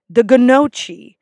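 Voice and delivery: ganochi drawn out as guh-NOH-chee; mispronounced gnocchi. Retold or imitated. Server quoting guests, mispronounced "ganochi" as guh-NOH-chee. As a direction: sound the g in "ganochi", slowly. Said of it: "that mispronounce this word".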